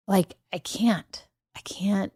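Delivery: breathless slow whisper; awed whisper. Real, not acted. Host reflecting on three years of podcast, whispering in awe. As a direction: barely breathe it, overcome.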